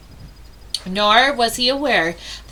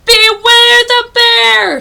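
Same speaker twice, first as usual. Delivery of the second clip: much louder and higher, very slow spooky call; bear voice very close; 13 semitones higher, 11 dB louder, much slower than usual. Acted. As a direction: let the bear roar right overhead. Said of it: "And closer".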